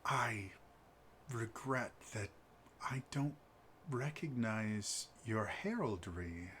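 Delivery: smooth a diplomatic apology over softly; soft-spoken noble. Acted. Noble Alex continues.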